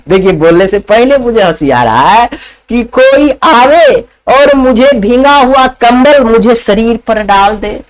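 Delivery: usual speaking voice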